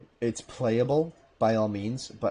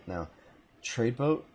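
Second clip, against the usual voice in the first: ordinary talk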